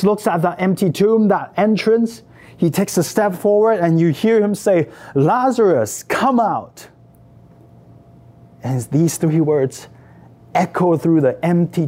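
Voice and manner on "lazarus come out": higher-pitched, drawn-out summons; calling out. Acted. Jesus calls the dead man out of the tomb, calling out. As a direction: call the name into the distance, commanding.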